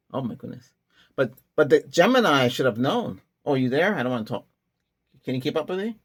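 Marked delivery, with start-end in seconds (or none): none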